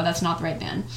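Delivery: plain reading voice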